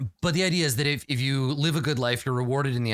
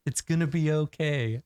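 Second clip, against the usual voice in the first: soothing higher dream voice; dreamy distant voice; 6 semitones higher than usual. Pretend. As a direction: coo reassurance from afar. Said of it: "Shut up, dream voice".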